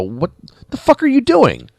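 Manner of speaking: high-pitched baffled outcry; mock disbelief. Voicing baffled reaction to bad writing.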